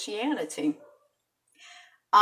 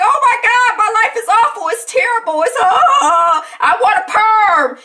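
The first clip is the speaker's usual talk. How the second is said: high, loud laughing wail; little girl wailing to her mother for a perm, high and loud; whiny child tantrum; 11 semitones higher, 9 dB louder than usual. Retold or imitated. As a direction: melodramatic kid begging for a perm. Her child self whining dramatically.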